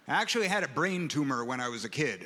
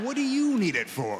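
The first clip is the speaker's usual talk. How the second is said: louder, higher, indignant retort; indignant echo; 7 semitones higher, 4 dB louder than usual. Retold or imitated. Boy firing nurse's question back, louder indignant retort. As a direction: throw the question back hard. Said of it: "I said".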